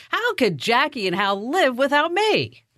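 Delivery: high-pitched, self-important incredulity; mock indignation. Host recalling her thought about the couple leaving, mock indignant. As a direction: play the comically indispensable boss.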